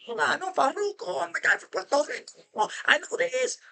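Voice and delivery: very high, imitated Caribbean accent; squeaky mock of the hustler bragging; Puerto Rican hustler. Hustler claiming to be from Puerto Rico, high mock accent. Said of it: "he's just talking".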